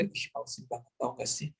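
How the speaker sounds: very quiet, whispery grumble; reluctant grumble. Shown as a demonstration. Teacher contrasting happy talk with an unwilling student, hushed reluctant grumble. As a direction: grumble it under your breath, fed up. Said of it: "Lain halnya".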